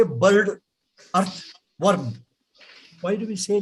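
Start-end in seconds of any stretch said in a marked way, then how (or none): 0.0-2.1 BIRD, EARTH, WORM each short with sounded R; local pronunciation. Shown as a demonstration.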